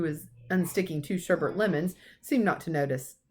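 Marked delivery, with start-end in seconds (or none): none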